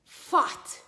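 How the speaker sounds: "Fought" for pot, top teeth on lip; F in place of P. Shown as a demonstration. Teacher testing the child's ear, deliberate F for P. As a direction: start "Fought" with a hissed F, not a popped P. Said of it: "am I saying the F sound".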